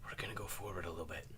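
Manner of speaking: hushed whisper, quick. Acted. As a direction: whisper it close to his ear. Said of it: "lean down and whisper".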